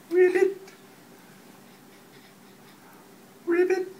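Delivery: high, playful froggy croak; a squeaky little frog ribbit for baby. Pretend.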